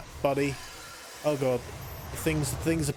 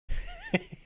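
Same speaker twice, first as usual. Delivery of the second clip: a burst of high-pitched giggling; real helpless high laugh; high squeaky laugh; 4 dB quieter than usual. Real, not acted. Laughing despite telling his friend to stop.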